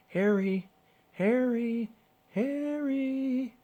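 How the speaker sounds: high, far-off calls, rising each time; man calling from far away; distant calling. A narrator's reading. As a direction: call out from far across the beach.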